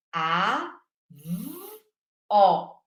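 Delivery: "A, V, O" as three isolated sounds with pauses. Shown as a demonstration. Teacher showing three bits of sound, "A, V, O" separately. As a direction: sound out "avó": A, buzzed V, open O. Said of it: "eu tenho três pedacinhos de som".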